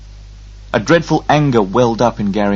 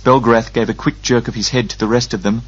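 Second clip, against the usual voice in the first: plain reading voice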